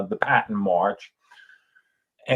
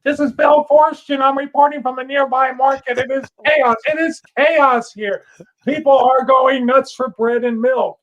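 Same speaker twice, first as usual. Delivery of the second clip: much higher, strained reporter voice on a bad line; faked field report; 12 semitones higher, 4 dB louder than usual. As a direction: play a frantic reporter calling in from chaos. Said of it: "we actually put, like, a grainy sound".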